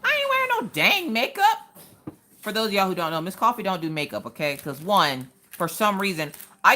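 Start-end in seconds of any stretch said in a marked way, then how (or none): 0.0-1.8 high-pitched, outraged no; indignant refusal. Retold or imitated. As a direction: squeal it like the idea is absurd. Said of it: "I'm like".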